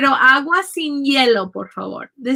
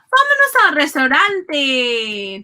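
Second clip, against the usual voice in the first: raised, drawn-out cheer to get going; lively call; 3 semitones higher, slower than usual. Shown as a demonstration. Teacher acts out leaving the taxi for the restaurant, lively call. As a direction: call it out slowly and eagerly.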